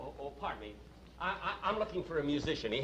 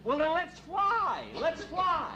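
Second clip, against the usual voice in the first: eager; 13 semitones higher, 4 dB louder, slower than usual. Acted. Eager angel urging cab faster.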